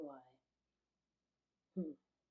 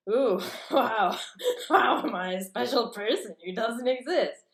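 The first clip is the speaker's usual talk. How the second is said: loud, higher sarcastic wow; mock amazement; 4 semitones higher, 8 dB louder than usual. Ranting at an absent someone about being special, in loud mock amazement. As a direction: gush in loud mock wonder.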